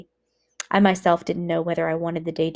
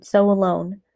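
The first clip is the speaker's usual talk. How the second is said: usual speaking voice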